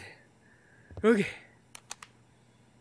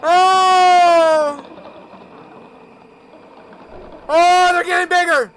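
sudden high startled cries; startled yelps; 10 semitones higher, 8 dB louder, much slower than usual. Real, not acted. Gamer startled by something onscreen, high cries. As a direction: jump out of your skin at the screen.

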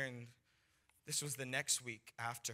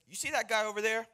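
high, loud eager leader; leader giving orders; 9 semitones higher, 10 dB louder, faster than usual. Retold or imitated. Leader assigning him a stranger, eager and loud. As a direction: point out the target loudly.

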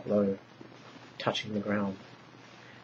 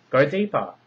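loud, higher command; sharp order; 6 semitones higher, 8 dB louder than usual. A narrator's reading. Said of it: "Potaniah ordered".

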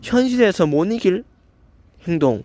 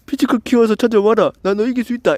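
mocking the commenter; 5 semitones higher than usual. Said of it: "이렇게 하는 거 아니야?".

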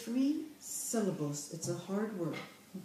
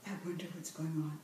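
modelled wonder; 4 semitones lower, 8 dB quieter, much faster than usual. Shown as a demonstration. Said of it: "we'll emphasize expression".

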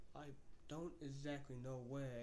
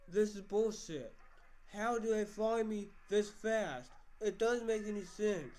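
loud, slow, fed-up rant; shouting in slow frustration; furious outburst; 7 semitones higher, 9 dB louder, slower than usual. Real, not acted. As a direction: spit each word out in real anger. Fed up after being found.

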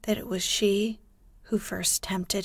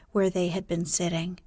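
plain reading voice

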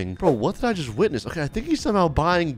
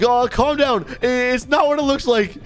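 nervous plea; 7 semitones higher, slower than usual. Real, not acted. Pleading after being caught.

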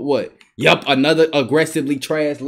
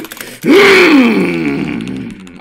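loud rising fake cry; mock wail; 7 dB louder than usual.

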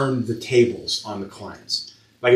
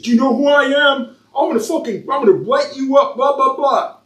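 higher, louder mocking rant; mock furious father; 13 semitones higher, 4 dB louder than usual. Tattoo artist mimics a father ranting on the phone, higher and mocking. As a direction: parody an entitled dad threatening you.

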